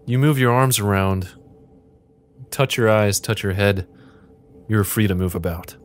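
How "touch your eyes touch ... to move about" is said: normal talking voice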